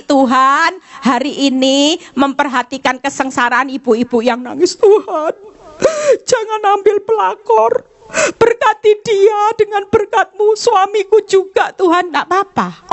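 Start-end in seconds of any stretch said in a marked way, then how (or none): 4.8-12.1 high pleading prayer voice; a touch of a pious wife praying; mock prayer. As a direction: pray sweetly for the rival, half joking.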